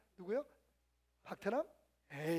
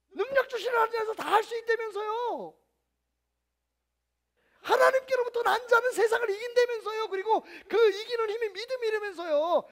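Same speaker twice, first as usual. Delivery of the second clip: higher, louder, pleading with a catch; impassioned plea; 10 semitones higher, 10 dB louder than usual. Pretend. Pastor protesting to God, loud, pleading, tearful. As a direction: argue with God, near tears.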